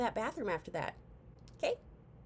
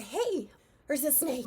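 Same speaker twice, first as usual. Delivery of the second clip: raised, louder warning that cuts off short; alarmed call; 7 semitones higher, 6 dB louder than usual. Retold or imitated. Teen alerting her aunt to a snake, loud alarmed call. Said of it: "I alert Aunt Ruth".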